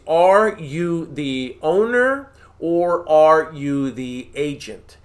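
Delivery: very slow, every word spaced out; point blank. Shown as a demonstration. Coach showing how to ask the seller point blank, very slowly. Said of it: "Ask them that question point blank".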